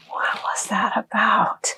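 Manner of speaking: hushed puzzled whisper. Retold or imitated. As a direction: breathe it out, baffled. Puzzled aside in retold moment.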